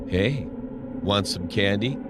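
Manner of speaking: ordinary delivery, nothing marked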